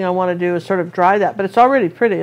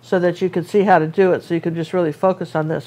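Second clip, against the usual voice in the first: ordinary talk, nothing marked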